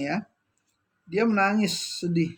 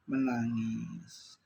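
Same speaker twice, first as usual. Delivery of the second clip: slow, much softer and lower, drawing out the word; sorrowful hush; 6 semitones lower, 6 dB quieter, much slower than usual. Retold or imitated. Preacher quoting the Prophet to the congregation, slow sorrowful hush. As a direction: let the weeping word sink into a sad hush. Prophet's words about weeping angels.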